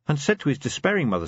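plain reading voice

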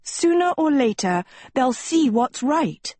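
put-on high, sweetly assured girl; sugary high-voiced confidence; kindest smile. A narrator's reading. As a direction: smile through it, pitched high. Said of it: "Sophie smiled her kindest smile".

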